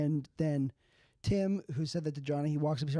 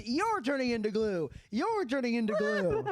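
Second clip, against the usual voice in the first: mock Oprah announcing to a horse audience, higher; 7 semitones higher than usual. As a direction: belt it like a talk show host giving away prizes. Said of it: "And Oprah says".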